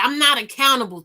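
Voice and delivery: normal talking voice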